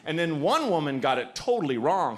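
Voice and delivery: usual speaking voice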